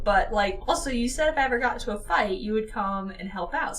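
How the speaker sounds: ordinary delivery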